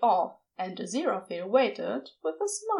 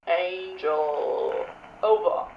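Crowley's radio voice; 5 semitones lower, much slower than usual. A narrator's reading. Crowley over walkie-talkie.

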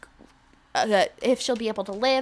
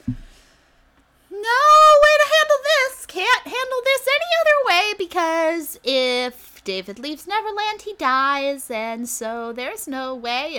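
Mary Margaret; 8 semitones higher, 4 dB louder, slower than usual. Retold or imitated. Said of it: "Mary Margaret says".

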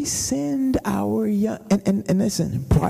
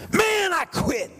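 put-on young man whining; 11 semitones higher than usual. Young man announcing he quit.

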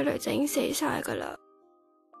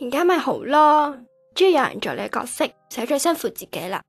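loud, aggrieved declaiming; bitter outburst; 9 semitones higher, 5 dB louder than usual. Acted. Daughter telling absent family she has been replaced, bitter. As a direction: raise the voice through hurt.

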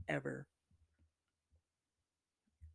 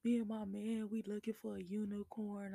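pitched up, a sing-song mock of an eager couple; mocking couple's voice; 6 semitones higher than usual. Mocking a couple who want a third, higher chirpy voice. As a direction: imitate them chirpy and higher. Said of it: "Someone will be like".

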